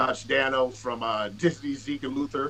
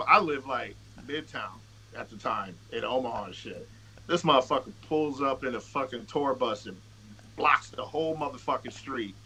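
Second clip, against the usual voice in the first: ordinary talk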